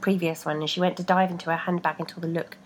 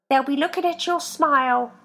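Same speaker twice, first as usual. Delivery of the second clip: higher, louder encouragement; foot voice; 8 semitones higher, 4 dB louder than usual. A narrator's reading. Feet encouraging Rebecca, higher voice. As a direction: encourage her.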